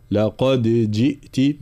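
لقد جئت slowly with a clear i on جئت; careful لقد جئت; clear kasra. Shown as a demonstration. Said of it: "تحقيق حركة الكسر في الجيم".

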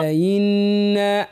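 very slow chanted آئنا, each sound held; long first hamza. Shown as a demonstration. Teacher leading listeners to repeat, very slow chanted آئنا. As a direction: hold آي long, then glide through آئنا. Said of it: "ترى عاودوا".

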